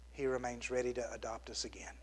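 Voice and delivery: hushed, tender whisper. Real, not acted. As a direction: breathe the promise softly. Tender close to sermon point.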